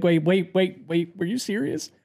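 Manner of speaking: imitating a needy worrier; mock panic. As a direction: check nervously, fearing the worst. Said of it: "I'll just have a panic attack".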